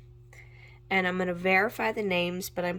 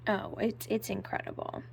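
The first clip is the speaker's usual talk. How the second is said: ordinary talk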